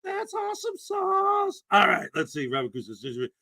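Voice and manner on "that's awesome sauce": much higher, slow, squeaky exclamation; silly falsetto cheer; goofy squeaky voice. Acted. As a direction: squeal it like a cartoon character. Goofy reaction to chat.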